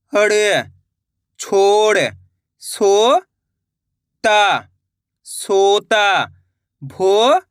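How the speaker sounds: "छो... हड़... छोड़": syllables held long, then blended; long held vowels as in "सो... ता... सोता"; syllable-by-syllable reading. Shown as a demonstration. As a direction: stretch "भो... ला... भोला", pausing between parts. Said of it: "किस तरीके से".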